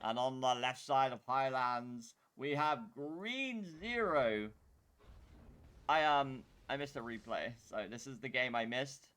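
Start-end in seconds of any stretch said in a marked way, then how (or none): 0.0-4.5 announcer voice. Acted. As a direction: announce it like a tournament caster. Casts the final game intro.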